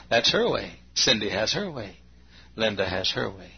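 ordinary talk, nothing marked

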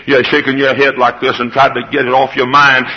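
usual speaking voice

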